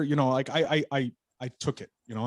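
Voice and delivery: ordinary talk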